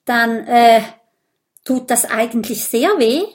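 loud throat-clearing and halting starts; nervous hesitation. A narrator's reading. Minimo asks if the blood test hurts, nervously hesitant. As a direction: stall with ums, then blurt the question. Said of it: "Er räuspert sich und sagt".

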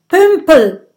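loud, high flustered shout; shouted insult. Acted. Gudröd shouting in the insult duel, loud and flustered. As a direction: yell the insult and then bounce it back. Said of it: "ruft Gudrud".

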